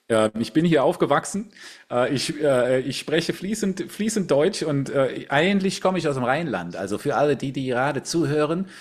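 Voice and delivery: broad Rhineland Kölsch accent in German. Shown as a demonstration. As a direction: lean into the Rhineland dialect, playfully. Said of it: "in einem Akzent spreche".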